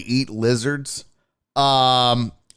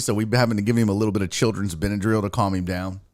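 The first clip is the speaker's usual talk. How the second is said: ordinary delivery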